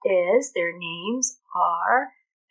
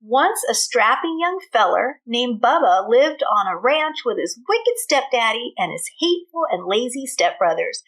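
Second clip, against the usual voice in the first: lively read-aloud with a country twang; storybook reading; 5 semitones higher, 6 dB louder than usual. A narrator's reading. Teacher reading a cowboy tale to her class, lively twang.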